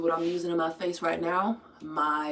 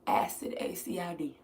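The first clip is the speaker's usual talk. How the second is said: whisper; 3 semitones lower, 8 dB quieter than usual. Real, not acted. Spelling after repeat.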